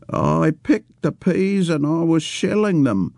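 put-on high, slow, childlike old man's voice; childish old man. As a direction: plead like a simple old man, slowly.

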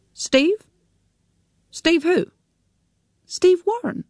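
high, disbelieving repeat of the name; can't believe the name he hears; incredulous. A narrator's reading. Son hearing his mother's lover named, incredulous and high.

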